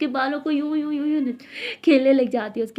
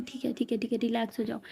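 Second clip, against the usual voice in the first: much softer, calming; soothing hush; 8 dB quieter than usual. Acted. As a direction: settle her down gently. गुस्साई दोस्त को शांत करना. (धीरे से शांत कराते हुए)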